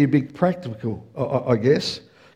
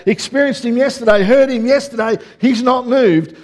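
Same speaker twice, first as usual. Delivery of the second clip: higher, louder sermon push; preaching at full force; 5 semitones higher, 4 dB louder than usual. Acted. Pastor urges the church that God has not moved. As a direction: drive the point home to the congregation.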